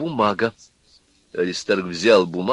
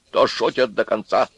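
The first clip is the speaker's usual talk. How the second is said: plain reading voice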